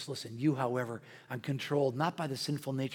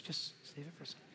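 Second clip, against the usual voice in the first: much softer, the wife's dry aside; husband quotes his wife's retort, hushed and teasing; quiet wife retort; 16 dB quieter, faster than usual. Retold or imitated. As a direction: drop it down like a muttered wife. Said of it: "She says".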